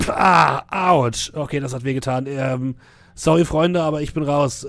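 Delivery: pained radio call, loud cry; hurt pilot yelps, then signs off; over walkie talkie. Acted. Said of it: "hört ihr über Funk".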